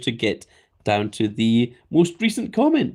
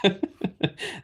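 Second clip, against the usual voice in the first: laugh; 7 semitones higher, 6 dB quieter than usual. Real, not acted. Laughs after impression.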